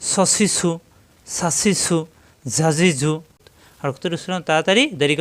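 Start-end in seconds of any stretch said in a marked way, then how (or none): 0.0-3.1 সসিসু সাসিসু ঝাজিঝু run with whistling air; quick sibilant drill; hissing drill. Shown as a demonstration. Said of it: "সফির মানে বাতাস বের হওয়া".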